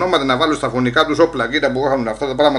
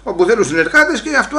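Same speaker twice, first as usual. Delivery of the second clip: ordinary talk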